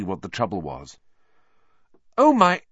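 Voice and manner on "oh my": high, loud gasp of shock; shocked exclamation. A narrator's reading. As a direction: exclaim it in alarm. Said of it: "Hermione exclaimed".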